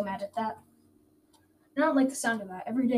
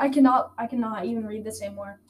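normal talking voice

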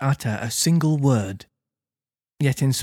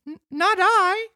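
high puppet voice, stuttering in fright; frightened Pinocchio denies it to the police; stammering. Acted. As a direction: stammer it out breathless and scared. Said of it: "stammered the marionette".

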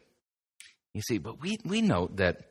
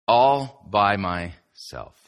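slow, drawn-out phrase with pauses; 2 semitones lower, much slower than usual. Acted. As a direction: stretch out each word for emphasis. Sets up the song reference.

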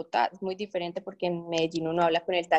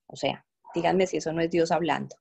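ordinary talk

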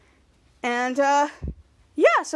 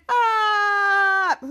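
battle yell; 5 semitones higher, 5 dB louder than usual. Retold or imitated. Jeff charging at Captain Man.

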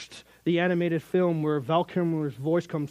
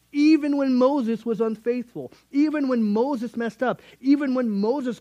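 rising preaching; 7 semitones higher, 4 dB louder than usual. Real, not acted. Preaching builds to climax.

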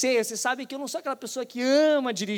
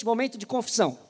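usual speaking voice

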